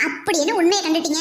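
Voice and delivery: usual speaking voice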